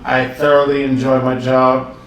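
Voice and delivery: ordinary talk, nothing marked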